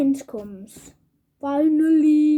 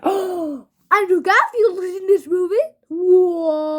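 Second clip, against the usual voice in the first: sudden gasp, then a louder, higher question; gasped surprise; 4 semitones higher, 5 dB louder than usual. Real, not acted. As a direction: catch your breath in disbelief. Gasping surprise at the discovery.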